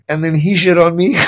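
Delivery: normal talking voice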